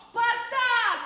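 loud high shout; крик. Acted. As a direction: yell it up to a window. Roddom shouting through window. (громкий крик)

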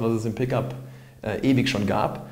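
ordinary talk

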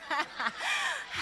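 a high, shrieking burst of laughter. Acted. Wife laughing at husband's cheeky remark, high shriek. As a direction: squeal with sudden laughter.

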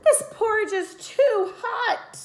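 high, slow, exclaimed cry of dismay; exclaiming Goldilocks. A narrator's reading. Goldilocks exclaims over hot porridge, high and slow. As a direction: gasp it out, shocked by the heat. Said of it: "She exclaimed".

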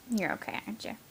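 usual speaking voice

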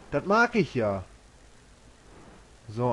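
ordinary talk, nothing marked

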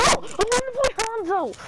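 high, slow excited shout. Real, not acted. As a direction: belt out the hero pick. Excited announcement of hero pick.

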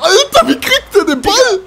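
high, loud, laughing outcry; incredulous yell. Real, not acted. Fassungslos über Gegner. (lauter, hoher, fassungsloser Ausruf)

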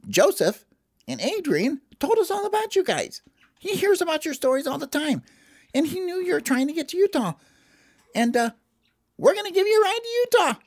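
fast, very high elf chatter; quick high cartoon elf; Gerald. Elf tells them of the ride.

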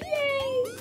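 very high, soft squeal of joy; squealed yay. Retold or imitated. Thanking boyfriend for the toy, high breathy squeal. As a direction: squeak it out with pure glee. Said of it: "I was just like".

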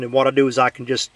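ordinary delivery

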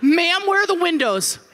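high, urgent mock panic; frantic appeal to a guide; panicked demand. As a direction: blurt it out in acted desperation. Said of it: "and then the panic".

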